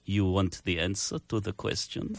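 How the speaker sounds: mock Russian villain, dropping to a quiet threat; Russian Bond villain. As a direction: play a hammed-up Russian spy-film baddie. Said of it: "Russian bad guy from the James Bond".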